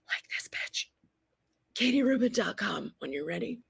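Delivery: cheeky whispered plug; conspiratorial whisper, half joking; whispered aside. Real, not acted. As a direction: drop to a sly whisper for the self-promo.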